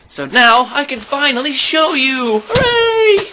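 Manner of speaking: high, loud gleeful announcement; excited reveal. Real, not acted. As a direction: burst out with a squealed hooray. Revealing the birthday gift on camera.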